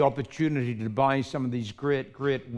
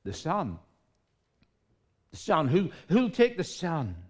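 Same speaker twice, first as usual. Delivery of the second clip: higher, earnest asking; pleading auctioneer; 5 semitones higher than usual. Acted. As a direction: plead for someone to take it.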